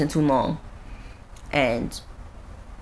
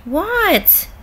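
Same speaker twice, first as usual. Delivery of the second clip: a sudden much higher disbelieving what; startled what; 11 semitones higher than usual. Real, not acted. Reacting to her friend agreeing, surprised and high. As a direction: jolt up in surprise.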